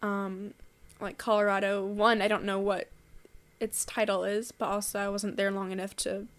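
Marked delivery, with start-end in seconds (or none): none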